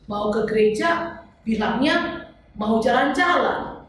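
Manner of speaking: ordinary talk, nothing marked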